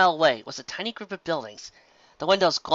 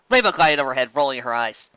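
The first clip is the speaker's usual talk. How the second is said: plain reading voice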